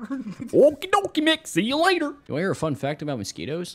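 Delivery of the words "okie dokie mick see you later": Goofy voice. Pretend. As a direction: draw it out like Goofy. Repeats Goofy farewell.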